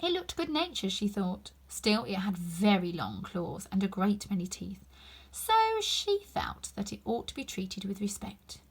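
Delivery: usual speaking voice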